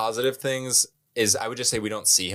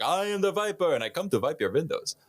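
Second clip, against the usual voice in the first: higher, hammed-up spooky reveal; punchline voice; 8 semitones higher than usual. Window washer reveals himself to the kid, hammed-up spooky voice. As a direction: play the scary man turning out harmless. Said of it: "He's like".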